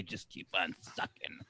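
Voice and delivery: very low, whispered instruction; mock-soothing whisper to a smothered child; hushed reassurance. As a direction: trail down to a near-silent murmur. Parent quietly coaxing smothered child.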